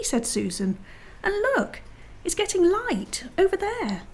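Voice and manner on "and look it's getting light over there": high, wondering call to look; excited discovery. Acted. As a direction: point it out excitedly, pitch lifted high. Susan points out the light.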